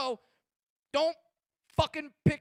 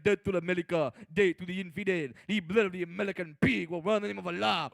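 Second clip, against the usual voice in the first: lower, menacing mock jihadist threat; mock terrorist tape; 6 semitones lower, faster than usual. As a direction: growl it like a hostage video. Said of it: "making those death tapes".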